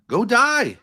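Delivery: high and loud, cutting; angry shout. Real, not acted. Arguing partner shouts at her in fury. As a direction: hurl it at them furiously.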